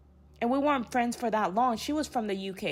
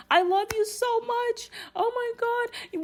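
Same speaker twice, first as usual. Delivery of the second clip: mock tearful; 14 semitones higher than usual. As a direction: act out weepy affection in a high voice. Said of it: "in the corner crying".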